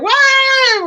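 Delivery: high, loud drawn-out "waah" scream; loud mock scream from passing drivers; imitated scream. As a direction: mimic passersby screaming a long wail. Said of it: "were screaming".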